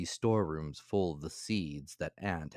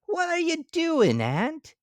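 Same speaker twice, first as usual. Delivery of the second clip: high, loud cartoon grasshopper; bright piping insect voice; 8 dB louder than usual. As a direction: squeak it out as the carefree grasshopper. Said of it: "she asked".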